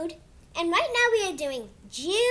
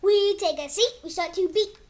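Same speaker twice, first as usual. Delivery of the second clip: put-on reading to kids; 3 dB louder than usual. Kid reading his book to viewers in storybook voice. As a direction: read it like a bedtime book for little ones. Reads picture book aloud.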